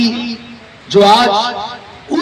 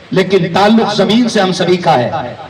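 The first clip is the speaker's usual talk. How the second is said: ordinary talk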